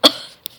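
sudden embarrassed cough. A narrator's reading. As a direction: cough mid-sentence. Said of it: "I just coughed in front of her".

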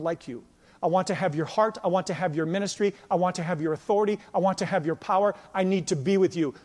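soft, low, earnest longing, almost confiding; quiet heartfelt plea. Pretend. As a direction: plead quietly with a mentor you want to follow. Imagined plea to a spiritual father.

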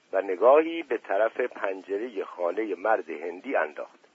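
usual speaking voice

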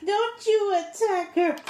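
high, loud, drawn-out mock scolding; mock outrage. Co-host jokingly defends a congresswoman, mock outrage. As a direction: pretend to leap indignantly to her defense.

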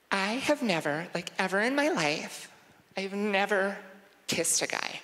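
put-on high, girly confession; put-on girlish secret-sharing voice; confessing college girl. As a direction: admit it haltingly as a girl. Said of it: "I'm gonna admit something to you".

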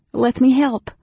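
high, loud woman's plea; urgent offer. A narrator's reading. Anne Beryl reaching out to coughing Raistlin, urgent and high.

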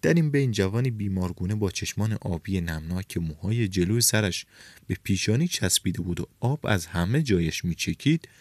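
ordinary delivery, nothing marked

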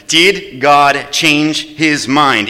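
very slow, each word weighed; slow weighty question. Real, not acted. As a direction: let the question hang.

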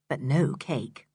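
ordinary delivery

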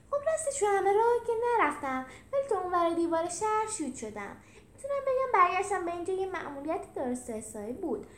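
high, loud chirpy character voice; high-pitched, eager boasting; cheerful Felix. Acted. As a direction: explain proudly and brightly.

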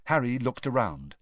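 usual speaking voice

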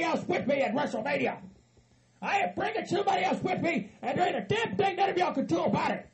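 high, defiant shouted boasting. Acted. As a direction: declare the comeback in an angry yell. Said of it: "to raise your damn voice".

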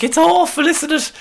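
high, lightly put-on Irish accent; mock Irish parents. Actor parents pleading with the Flash, mock Irish accent. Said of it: "why are you speaking with an Irish accent".

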